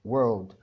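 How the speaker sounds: world with a curled R before the L; teacher recalls the R in world; English world. Shown as a demonstration. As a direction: say world with a full American R. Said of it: "حرف ال R وكلمة world".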